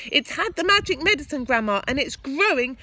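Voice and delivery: put-on high, loud, fast excited boy; excited shouting. A narrator's reading. George shouting to Grandma about the magic medicine. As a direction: blurt it out hopping with excitement. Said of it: "pointing at the enormous hen and shouting".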